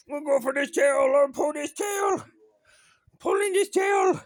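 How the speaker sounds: gleeful mock threat to the dog; playful teasing shout. Real, not acted. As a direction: rile up the puppy.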